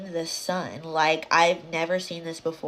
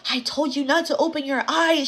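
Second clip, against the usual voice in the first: raised, high-pitched retold scolding; scolding grandmother; 9 semitones higher, 6 dB louder than usual. Grandmother bursts in on disobedient child, mock scolding. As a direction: scold loudly as an exasperated grandmother.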